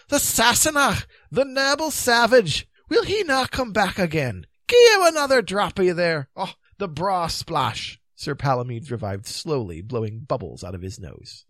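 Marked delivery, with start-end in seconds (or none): 0.1-8.0 high, loud Scottish clucking; pitying cries in broad Scots; compassionate Scots. A narrator's reading. Old wives reviving the knight with water, Scottish fussing. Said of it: "they said compassionately".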